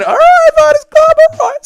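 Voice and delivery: very high, loud squeal; sudden falsetto shout. Real, not acted. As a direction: squeal it out like a cartoon.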